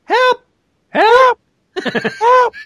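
very high, loud falsetto yelps; squeaky cartoon cries for help; king from Kings and Balloons. Showing the co-host how the cartoon king goes, squeaky mock yelps. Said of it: "you gotta do it like, like the king from Kings and Balloons".

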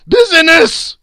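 very high, very loud outburst; piercing ghostly shout; ghost's cry. A narrator's reading. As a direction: cry it out in indignant anguish. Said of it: "cried the ghost".

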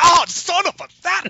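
high, loud pained yelp; stubbed toe. Retold or imitated. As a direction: yelp it out like you just stubbed your toe. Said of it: "like he stubbed his toe on Dangard's foot".

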